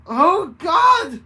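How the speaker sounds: loud, high scream of pain; agonized scream. A narrator's reading. Bennett screams in agony as claws pop out. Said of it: "Bennett screamed in agony".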